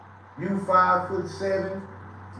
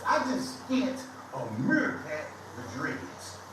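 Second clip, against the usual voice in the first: slow punchline; 3 semitones lower, 4 dB quieter than usual. Real, not acted. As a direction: say "meerkat with dreads" slowly and deliberately. Retelling insult, repeats punchline.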